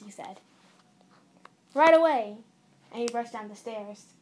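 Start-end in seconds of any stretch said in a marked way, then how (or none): 1.7-2.4 loud, higher prompt reply; little recruit jumping to it; eager obedience. A narrator's reading. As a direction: answer your commander at full voice. Little soldier brother obeys an order.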